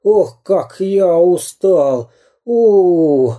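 high, slow, worn-out whining; exhausted cartoon bandit wailing; pleading villain. A narrator's reading. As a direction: beg and scold while running out of puff. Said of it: "умолял он".

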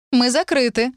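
understated shout from another room; calling out. A narrator's reading. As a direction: call to a late customer, not too loud. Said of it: "крикнула она".

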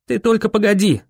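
high-pitched urgent plea to hold on; insistent urging. A narrator's reading. Boy carrying wounded Viola downhill, insistent urging. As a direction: keep her going, pitch lifted. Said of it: "твердил я Виоле".

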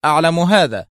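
curt authority; stern. A narrator's reading. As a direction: state it strictly, no discussion. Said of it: "وهو يقول في صرامة".

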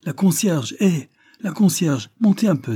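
high, loud call down the stairwell. A narrator's reading. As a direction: shout for the concierge from upstairs. (appel crié, voix haute et forte)

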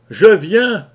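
loud, high shout; cry out. Acted. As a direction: call out boldly. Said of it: "criai-je". (cri fort et aigu)

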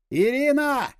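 high, drawn-out call of a name. A narrator's reading. Comrades on the roof call to Irina, calling out. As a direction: call out across a distance, pitched high.

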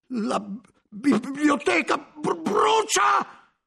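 stuttering, breaking into a high shout; stammering alarm. A narrator's reading. Nicola realizing the library burns, stammered terrified shout. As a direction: stumble on the words then burst out.

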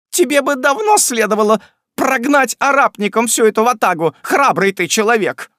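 high, loud shrill nagging; scolding wife. Acted. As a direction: sneer at the cowardly husband. (сварливая жена громко пилит мужа)

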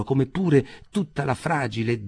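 ordinary delivery, nothing marked

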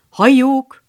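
loud, high cheering; hear hear shout. Acted. Joe cheers the new member's speech, loud call. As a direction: cheer the speaker on loudly. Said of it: "kiáltotta Joe".

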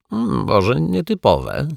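a slow grudging admission; throat-clearing waiter. A narrator's reading. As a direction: hem and haw like a waiter caught out. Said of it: "chrząknął kelner".

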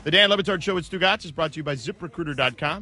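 ad read. Acted. Host reads sponsor ad.

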